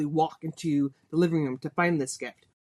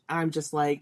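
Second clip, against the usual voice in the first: ordinary talk, nothing marked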